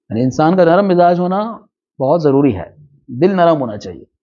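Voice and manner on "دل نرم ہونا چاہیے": ordinary talk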